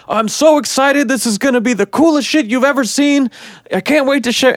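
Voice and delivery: high-pitched, over-the-top hype impression; squealy mock enthusiasm; gushing mock excitement. Mimicking everyone else's hyped interviews.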